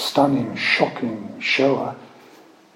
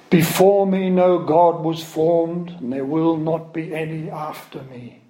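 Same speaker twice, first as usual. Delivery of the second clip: raised, solemn proclaiming of scripture; scripture reading; 4 semitones higher than usual. Acted. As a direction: declaim it like a prophet before a congregation.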